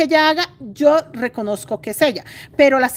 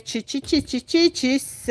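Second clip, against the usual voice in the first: soft, slow muttered "chi, chi, chis"; quiet fretful clicking syllables; 4 dB quieter, slower than usual. Real, not acted. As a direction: fret under your breath while fixing the yarn. Fussing over the yarn.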